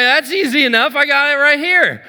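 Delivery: gleefully offering the card; eager. Retold or imitated. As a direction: naive husband eagerly giving his credit card. Eagerly giving card number.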